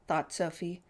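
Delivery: ordinary delivery, nothing marked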